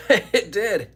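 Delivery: laughing it out. Real, not acted. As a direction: laugh through the words. Laughs about tight jersey.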